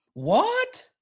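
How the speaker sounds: high rising shocked what; hammed-up surprise; mock shock. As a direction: throw up a startled what.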